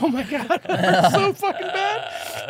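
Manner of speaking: high, loud, drawn-out pained wail; mock tearful wail. Patient sitting up after hernia surgery, mock crying in pain. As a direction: wail it like a hammed-up sob of agony. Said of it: "your eyes just start running".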